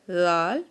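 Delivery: لال said with tongue between teeth; heavy th-like sound in لال; Arabic zaa. Shown as a demonstration. Teacher shows the zaa articulation. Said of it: "ویسے ہم اس کو ادا کریں گے زوا".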